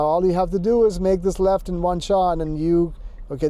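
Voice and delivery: normal talking voice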